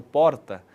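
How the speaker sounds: "porta" with one light tongue tap for R; tapped R. Shown as a demonstration. Teacher showing the capital's R, "porta" with a single tap. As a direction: tap the R of "porta" like a paulistano or gaúcho. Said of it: "um tapinha com a língua no céu da boca".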